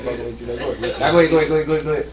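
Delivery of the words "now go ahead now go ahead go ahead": ordinary talk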